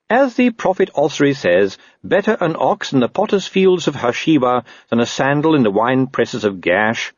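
high-pitched earnest quoting of scripture; prim, devout voice slowing to the close; pious constable. Acted. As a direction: recite it like a zealous believer. Narrator voices Visit quoting scripture.

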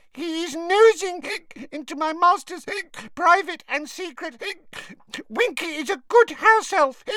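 high squeaky elf, loud and hiccuping; drunk ranting elf. A narrator's reading. Drunk Winky rants at Dobby about Harry prying.

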